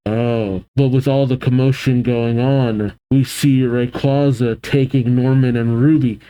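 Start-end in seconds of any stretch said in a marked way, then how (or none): none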